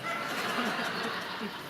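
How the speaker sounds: airy laugh, no voice; breathy laugh. Real, not acted. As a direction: laugh at your own fright. Laughs at own worry.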